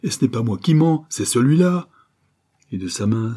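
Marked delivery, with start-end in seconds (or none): 0.0-1.9 crying out. A narrator's reading. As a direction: hammer each word, pointing at him. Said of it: "cria le malheureux".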